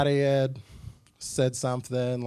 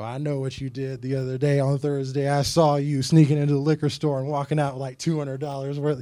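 mock accusing busybody; 2 semitones higher, 7 dB louder than usual. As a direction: call them out like a nosy church gossip. Voicing an accusing churchgoer.

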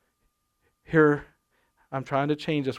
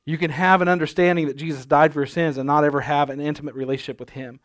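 normal talking voice

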